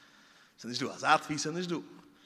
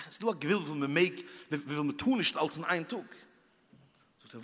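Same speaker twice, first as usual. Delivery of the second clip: ordinary talk, nothing marked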